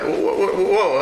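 high, startled whoa to calm him; retelling a startled backing-off. Retold or imitated. Backing off from a shove.

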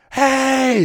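high loud hey to grab the room; calling out. Retold or imitated. Shouting into a packed bar to get attention, loud call. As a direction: yell to get the bar's attention.

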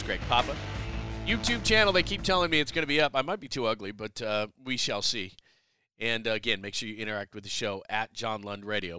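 ordinary talk, nothing marked

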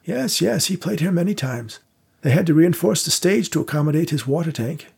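put-on an old vaudeville house keeper showing guests around; old theatre manager. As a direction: play the elderly manager, courteous and unhurried. Victor on Houdini.

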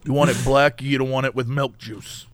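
hushed, low diner waitress role play; whispered asmr role play. Pretend. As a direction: murmur it softly like a waitress. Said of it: "it's like a diner".

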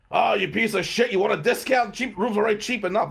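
loud, high mock outburst; irate hotel clerk. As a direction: imitate a clerk blowing up at a guest. Clerk explodes at discount request.

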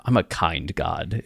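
quiet slow mock Xerxes, lightly voiced; Xerxes line. Quoting Xerxes from 300, quiet and slow. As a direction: murmur it slowly as a hint of Xerxes. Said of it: "the line from three hundred".

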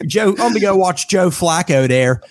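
playful demo of a Baltimore accent; hammed-up Baltimore vowels. Shown as a demonstration. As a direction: talk like a Baltimore local. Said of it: "in a Baltimorean accent".

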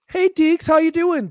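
high-pitched greeting to a dog; imitated pet greeting. Wife walks in and greets the dog, imitated high greeting. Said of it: "Anna was to walk in and, like, go like".

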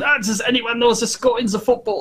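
put-on high-pitched comic German accent; mock German pilot. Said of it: "So there were all the Germans coming on".

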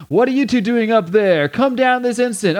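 high-pitched indignant scolding. Acted. Killjoy catches two up there, scolding. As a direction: tell them off, voice pitched well up.

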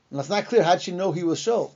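ordinary talk

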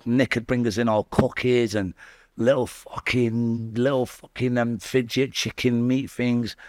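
normal talking voice

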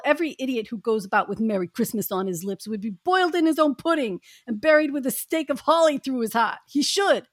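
a cranky miser's scornful rant; ranting miser Scrooge. Acted. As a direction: rail against Christmas with contempt. Scrooge's rant in group reading.

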